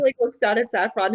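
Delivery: normal talking voice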